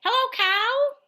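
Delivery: high, loud hello to cow; reading to a toddler. Acted. Reading to little kids.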